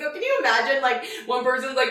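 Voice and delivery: ordinary talk, nothing marked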